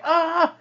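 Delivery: high mock yell of alarm. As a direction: yelp as if panicking. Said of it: "he starts yelling".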